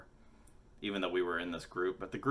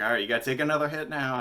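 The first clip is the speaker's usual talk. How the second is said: urging friend; 7 semitones higher, 9 dB louder, much faster than usual. Retold or imitated. Friend urging another hit.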